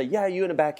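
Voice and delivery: high, brisk call to the back row. Retold or imitated. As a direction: point and call out like a lively teacher. Don calling from the front.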